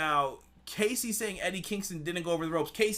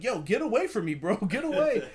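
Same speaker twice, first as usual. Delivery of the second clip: higher, louder mock irritation; annoyed brush-off; 6 semitones higher, 5 dB louder than usual. Host imitates Eddie Kingston waving off Jake, mock annoyed yell. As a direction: shoo the pest away. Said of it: "Eddie Kingston's just like".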